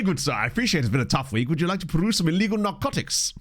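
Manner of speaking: mock-genteel salesman, pitch leaping high; posh dealer. Posh dealer pitching narcotics to a tired gentleman, hammed-up politeness. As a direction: lay on the courteous posh patter. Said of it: "posh pitch for a posh drug".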